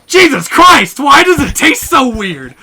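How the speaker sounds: loud, high yell of mock outrage; hammed-up furious shouting; retold angry outburst. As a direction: imitate a friend erupting in disgust. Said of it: "he got so mad".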